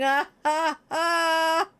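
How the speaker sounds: put-on gloating villain chuckle with accent; mock Putin laugh. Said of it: "there's Putin just laughing at us, going".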